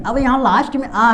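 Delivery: ordinary talk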